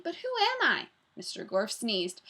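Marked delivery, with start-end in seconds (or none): 0.0-0.9 put-on high, bewildered child's question; storybook girl's raised, baffled cry; shouted confusion. A narrator's reading. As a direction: wail it high, puzzled. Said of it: "shouted Jenny".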